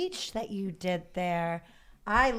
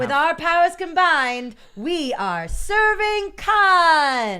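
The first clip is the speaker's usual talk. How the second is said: louder, higher superhero team shout; sassy drag queen; 8 semitones higher, 9 dB louder, slower than usual. Pretend. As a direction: declare it like a drag superhero duo. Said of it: "and then it'd be like".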